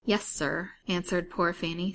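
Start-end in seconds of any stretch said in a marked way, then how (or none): none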